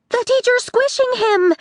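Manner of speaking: put-on high, alarmed girl voice; Nori crying out. A narrator's reading. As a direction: cry out in panic. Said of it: "Nori cried".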